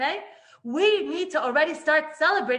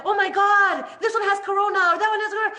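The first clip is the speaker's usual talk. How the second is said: higher, louder, alarmed chatter; mock fearful gossip; 6 semitones higher, 7 dB louder, faster than usual. People spreading corona fear, higher and louder in mock alarm. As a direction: sound scared about who is sick. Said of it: "that fear and that trepidation".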